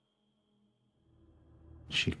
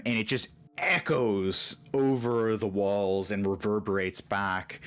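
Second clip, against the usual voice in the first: normal talking voice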